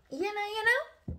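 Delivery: squeaky high, teasing you know; sing-song high you know?; goofy teasing repeat; playful high you know. Real, not acted.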